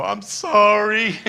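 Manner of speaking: high, loud, slow mock apology; apologetic wince. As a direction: brace for a scolding. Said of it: "I wanted to go".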